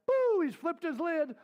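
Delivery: high, loud mock pop; mock outburst. Parenting teacher acts out a flipped lid, mock outburst. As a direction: blurt it out high and drawn out.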